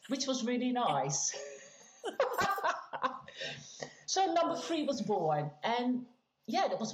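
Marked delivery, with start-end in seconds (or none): 2.0-4.0 breathy laugh. Real, not acted. Laughs after nice memory.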